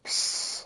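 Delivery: sharp voiceless psst. Acted. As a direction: hiss for someone's attention. Hissing for attention.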